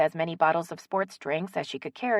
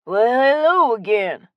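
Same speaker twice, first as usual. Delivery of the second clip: loud, drawn-out Southern hello; big, slow greeting from behind the counter; friendly shopkeeper greeting; 6 semitones higher, 9 dB louder, slower than usual. Acted. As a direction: stretch it out as a hearty welcome.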